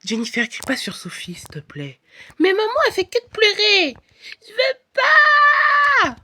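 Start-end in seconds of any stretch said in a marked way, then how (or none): 2.4-4.0 higher, louder whiny complaint; complaining daughter. Pretend. Jennifer complains to mother. 4.5-6.2 very high, very slow sobbing wail; crying toddler. Pretend. Toddler Sophie wails.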